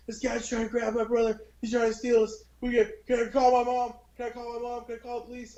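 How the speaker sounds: put-on high, panicky child voice pleading; frightened boy asking to call mom; scared kid. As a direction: beg the cashier in a rush of fear. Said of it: "and I go to the lady".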